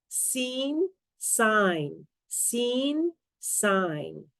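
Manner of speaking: careful, separated "Seen" and "sign" for ear training; minimal pair demo. Shown as a demonstration. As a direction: contrast the "ee" of "Seen" with the "eye" of "sign". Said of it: "pronounced almost the same except for one vowel sound".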